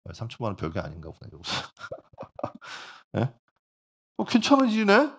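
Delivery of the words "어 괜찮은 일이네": friend's surprised question pitched much higher and louder; high, puzzled question from the friend. Acted. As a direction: ask it high and baffled, as if this is good news. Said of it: "라고 질문했죠".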